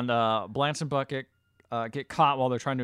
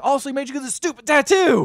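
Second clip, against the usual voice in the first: mock outraged father, high and loud; hammed-up indignant outburst; crappy dad; 13 semitones higher, 11 dB louder, faster than usual. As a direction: exclaim it like a fed-up dad. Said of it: "dad starts to be kinda crappy".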